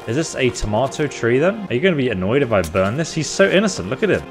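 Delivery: ordinary delivery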